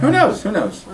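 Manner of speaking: playful shrug. Acted. Repeated quip, tune swings.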